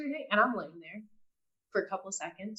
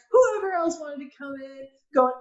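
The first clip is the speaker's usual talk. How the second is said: normal talking voice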